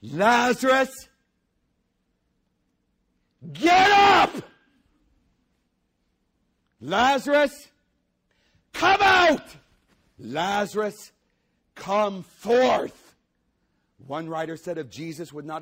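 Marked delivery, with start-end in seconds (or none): 0.0-4.4 booming high shout; loud cry. Acted. Preacher as Jesus crying out loudly at the grave. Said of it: "with a loud voice". 6.8-13.2 booming full-voiced shout, high and drawn out; loud cry. Preacher as Jesus shouting Lazarus out of the tomb. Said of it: "Cried out with a loud voice".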